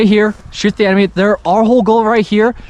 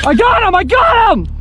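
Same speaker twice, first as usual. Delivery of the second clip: triumphant shout; 11 semitones higher than usual. Real, not acted. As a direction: crow over the kill. Kills enemy after Joe dies.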